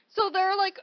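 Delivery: normal talking voice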